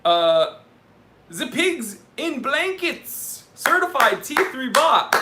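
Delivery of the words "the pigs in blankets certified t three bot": put-on loud, high German-accented announcement, drawn out at the start. Livestream host announcing a certified bot in a loud mock-German accent. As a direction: shout it out in a hammed-up German accent. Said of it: "say it in a German accent".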